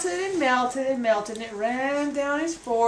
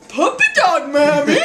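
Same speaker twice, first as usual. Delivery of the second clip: laughing reply; 2 semitones higher, 8 dB louder than usual. Acted. Boy answers, laughing.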